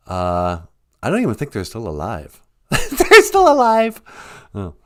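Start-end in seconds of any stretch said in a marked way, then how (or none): 2.7-4.0 very high cooing voice; high pet voice. Acted. A squeaky cooing voice praising the host's intro. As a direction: coo it like baby talk.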